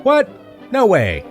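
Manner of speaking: ordinary talk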